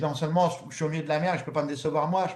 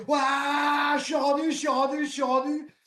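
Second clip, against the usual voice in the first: screaming; 10 semitones higher, slower than usual. Retold or imitated. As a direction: scream out the arrival. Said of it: "je pousse un hurlement".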